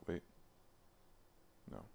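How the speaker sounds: ordinary delivery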